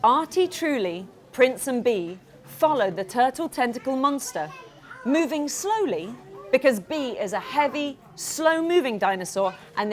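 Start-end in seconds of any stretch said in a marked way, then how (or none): none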